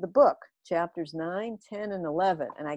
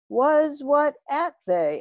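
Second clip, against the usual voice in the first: slow, higher, louder puzzled alien; confused alien; 6 semitones higher, 5 dB louder, slower than usual. A narrator's reading. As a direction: puzzle over it slowly like an alien. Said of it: "asked the trefinator".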